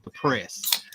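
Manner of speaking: normal talking voice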